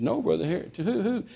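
soft, hesitant query; timid question. Retold or imitated. Cowed preacher asking who is to blame, timid question. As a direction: ask as if afraid of the answer.

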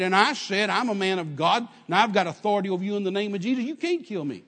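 loud, insistent comeback, retold; mad retort. Driver defying the gunman a second time, mad. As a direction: throw the words right back with angry authority. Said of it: "it made me as mad".

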